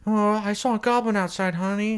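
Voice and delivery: high, singsong housewife voice; lightly mocked mom. Wife telling husband about a goblin, high mock-mom voice. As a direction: put on a high-pitched mom. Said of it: "his mom being like".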